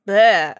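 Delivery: loud fed-up ugh; disgusted groan. Real, not acted. Groans at being abandoned.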